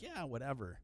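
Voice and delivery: soft, offhand dismissal; unbothered shrug. Retold or imitated. Locals react to shouting diner, unbothered shrug. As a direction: toss it away quietly, not caring. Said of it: "We'd just be like".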